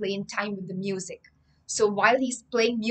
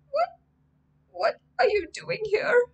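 put-on scared boy; 14 semitones higher, 4 dB louder than usual. Scared boy asks stranger.

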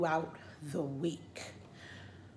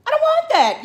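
even higher, louder, stress on want; louder refusal; 13 dB louder than usual. Real, not acted. Refusing the vaccine again, louder. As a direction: push the refusal harder.

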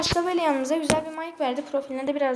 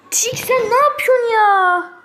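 much higher, much louder, slow crying whine; frustrated yell; 10 semitones higher, 11 dB louder, slower than usual. Real, not acted. Gamer yelling at teammate Tik, anguished frustrated cry. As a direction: wail at your useless teammate.

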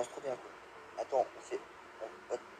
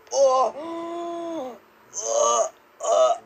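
panicked yelps; 7 semitones higher, 4 dB louder, much slower than usual. Real, not acted. Panicked cries while being attacked.

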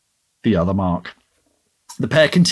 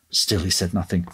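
ordinary delivery

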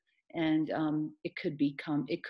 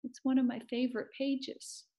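misty-eyed sorrow; 7 semitones higher than usual. Acted. Amanda mourns her torn page to Maniac, misty-eyed sorrow. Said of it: "She turned misty eyes to Maniac".

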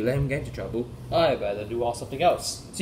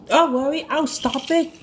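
high, chirpy engine voice; eager little engine; 13 semitones higher, 4 dB louder than usual. Acted. Rosie promising to stop the runaway tankers, high eager voice. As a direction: pipe up brightly, a small engine volunteering.

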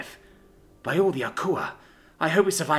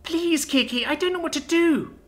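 very high, tearful plea; crying child; 13 semitones higher than usual. A narrator's reading. Little girl begging her unconscious sister, crying. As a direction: plead helplessly in a high child's cry. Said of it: "I say, crying".

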